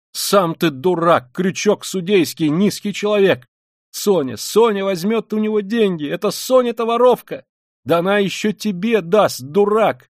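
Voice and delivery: high, forceful tirade of insults; furious Katerina Ivanovna. Acted. Katerina Ivanovna defending Sonya against Luzhin, high furious tirade. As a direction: hurl insults in indignant defence.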